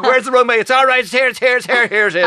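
loud, high hammed-up frantic landing; mock panic. Pilot hunting for tiny runway to land, mock panic.

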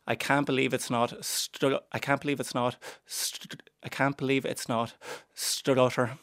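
block on the first sound of "Strutter", then restart; repeated stuck attempts at "Strutter" before it finally comes out; comic stammering. Comedian trying to say the show's name, comic stammering on "Strutter". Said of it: "me just trying to say it".

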